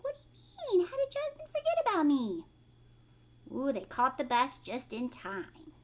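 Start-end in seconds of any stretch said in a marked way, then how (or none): 0.0-2.5 much higher, squeaky little pet voice; high cartoon pet asking in disbelief; Fluffy's voice. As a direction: play the hurt little guinea pig, high. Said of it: "So look, Fluffy's like".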